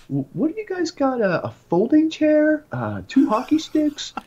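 high, slow, disbelieving cop listing items; mocking cop voice. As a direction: sneer through each item. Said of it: "he's like".

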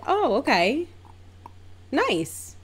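high-pitched, noncommittal pleasantry; high little social voice; polite underwhelm. Retold or imitated. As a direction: react politely but unimpressed, pitch up. Polite, underwhelmed reaction to price.

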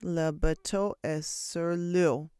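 very slow, drawn-out chant; slow class repetition. A narrator's reading. Students chanting the teacher's line back, slow. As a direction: drag it out like a class.

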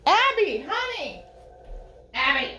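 high, sing-song call to the dog; calling out for the dog downstairs. Real, not acted. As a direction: call out to a dog in another room. Calling her dog from upstairs.

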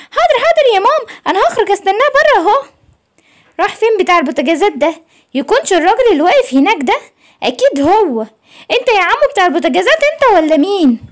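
put-on very high, chirpy child voice; a small kid obeying mum brightly; eager little cub. Simba answering mama and looking for the repairman, high and eager.

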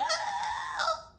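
high breathless scream "Ah!" as Jesse panicking; mock scream. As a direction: let out a sudden high unvoiced shriek "Ah!". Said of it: "Jesse's freaking out".

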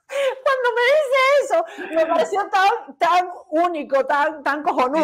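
high-pitched voice squeezed by laughter; cracking up, pitch way up; laughing while talking. Real, not acted. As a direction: tell it through a real laughing fit. Cracking up at the man's reply.

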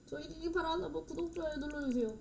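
ordinary delivery

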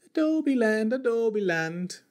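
lightly lilting high Irish; leprechaun. Acted. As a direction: sing it like a leprechaun. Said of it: "Why do I sound like a leprechaun".

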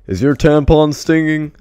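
loud, high imitation of a taunting kid; mock taunt. Rival kid to player at free throw line, taunting. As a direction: needle them, pushed up high and loud.

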